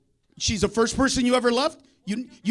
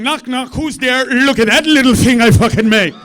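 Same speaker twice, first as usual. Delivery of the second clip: God joking; 9 dB louder than usual. Retold or imitated. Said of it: "God's way of going".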